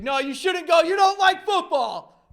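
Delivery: high, loud protesting quote; mock protest. Mimics someone objecting to birthday tickets.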